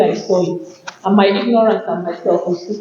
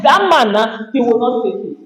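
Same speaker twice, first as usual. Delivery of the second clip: normal talking voice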